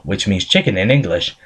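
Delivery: usual speaking voice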